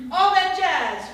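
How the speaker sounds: loud, high roar of a guess. Acted. Impatient Rob Reiner shouting charades guesses, roaring. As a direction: bellow the answer across the room. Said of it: "Rob Reiner roared".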